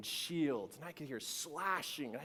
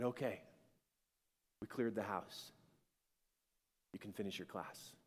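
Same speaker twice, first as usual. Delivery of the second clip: calm voice; 4 semitones lower, 6 dB quieter than usual. Retold or imitated. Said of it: "that same voice that had told me".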